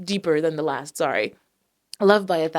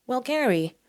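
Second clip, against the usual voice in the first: a high chirpy interviewer voice; lightly put-on host voice; 7 semitones higher than usual. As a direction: play a bright talk-show host asking Gary. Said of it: "I don't know why I'm doing that voice".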